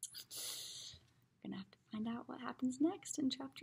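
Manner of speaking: hushed whispered sign-off. Real, not acted. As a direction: whisper the closing line. Reader signs off in whisper.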